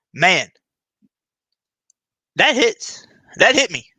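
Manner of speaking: loud, high-pitched burst; real outburst, high and punchy; floored reaction. Real, not acted. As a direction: blurt it out loud, really hit by it. Struck by the speech clip.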